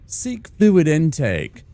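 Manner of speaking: high, slow, formal voice; stiff game prompt. Pretend. Streamer mocking a stiff in-game prompt, slow and formal. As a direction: read it like a proper British system prompt. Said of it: "something in-game where I could have".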